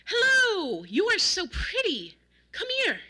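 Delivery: high, loud, gushing call; big exuberant bellowed greeting; bellowing. A narrator's reading. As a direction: holler a compliment across the yard. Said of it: "as he bellowed out".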